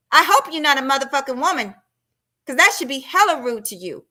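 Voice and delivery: usual speaking voice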